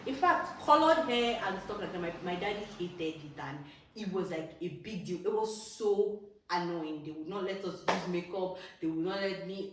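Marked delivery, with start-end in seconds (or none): none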